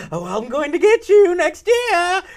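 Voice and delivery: high, loud cackling-villain call; Joker-like villain taunt. Retold or imitated. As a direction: gloat like a comic-book villain running away. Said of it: "like he's the Joker or the Riddler".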